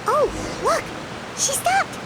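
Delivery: high, surprised exclamation; excited. Acted. Companion following the girl sees her halt, excited. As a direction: announce the sighting with a jump in pitch.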